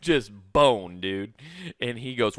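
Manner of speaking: normal talking voice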